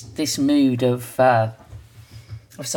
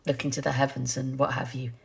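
ordinary talk

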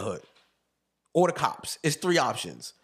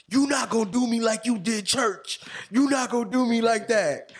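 high, loud furious outburst; angry yelling; 9 semitones higher, 4 dB louder than usual. Retold or imitated. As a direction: blow up at her, yelling. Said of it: "he snapped".

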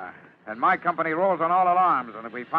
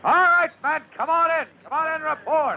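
calling out; 11 semitones higher than usual. Acted. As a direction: call the men back in. Lieutenant calling men back.

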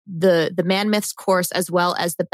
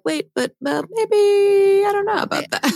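waver on a higher pitch, imitating reluctance; hesitant; 13 semitones higher than usual. Partner answering a suggestion, acted as hesitant.

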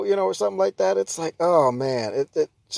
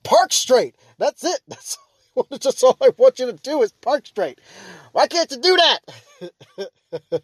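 high, loud exasperated plea at bad parkers; exasperated yell; 10 semitones higher, 3 dB louder than usual. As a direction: beg them to park straight, fed up. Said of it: "'cause I'm like".

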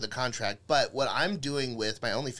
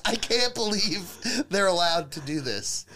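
usual speaking voice

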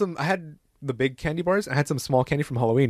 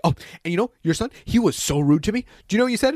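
mock outrage climbing higher; complaining administrator; 6 semitones higher than usual. Administrator complains to dad about rude son, mock offended. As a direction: imitate an offended complainer, lightly pitched up. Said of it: "she was like".

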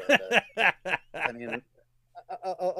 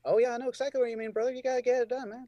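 hyped agreement; 9 semitones higher, 3 dB louder, faster than usual. Real, not acted. Enthusiastic bro agreement.

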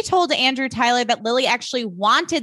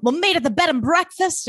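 a put-on old-movie hero voice; 1940s leading man; 6 semitones higher than usual. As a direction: play the forties screen hero, earnest. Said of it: "nineteen forties movies vibes".

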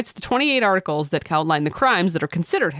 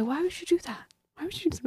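softer, higher mock disbelief; mock bafflement; 10 semitones higher, 9 dB quieter, faster than usual. Teasing the heretics with hushed mock disbelief. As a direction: ask it as if truly baffled. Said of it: "No, seriously, why would you do that?".

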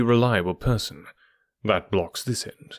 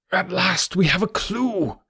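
much higher, slow, triumphant exclamation; excited cry; 8 semitones higher, slower than usual. A narrator's reading. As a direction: cry it out in relief, pitched up high. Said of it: "he cried".

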